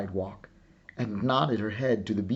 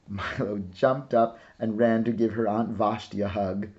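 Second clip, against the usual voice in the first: plain reading voice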